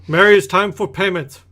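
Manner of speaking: calling out. Acted. Merchant calls into the mission for payment, calling out. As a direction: summon someone out of sight, high and loud. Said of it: "he calls into the mission".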